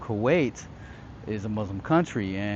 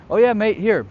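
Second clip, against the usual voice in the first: raised, loud fake British accent; terrible British accent; 8 semitones higher, 7 dB louder than usual. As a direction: put on a bad British accent. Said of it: "British accent I told you about that I'm terrible".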